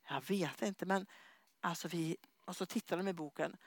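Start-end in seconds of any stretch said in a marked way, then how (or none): 0.1-2.2 soft, low, puzzled; bewildered murmur. Retold or imitated. Couple wonder what just happened, soft and bewildered. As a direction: trail off dazed and unsure.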